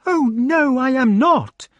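high, loud lady's denial; protesting lady. A narrator's reading. Lady denies being an adulteress, protesting. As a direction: protest in a high put-on woman's voice.

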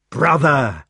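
loud, glad call; joyful cry. A narrator's reading. Knight running to tackle his brother, joyful cry. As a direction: call out on reaching a lost brother.

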